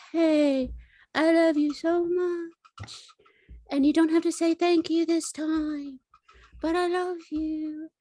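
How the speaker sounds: high, gentle imitation of a suitor; tender proposal. Character retells a man's tender proposal.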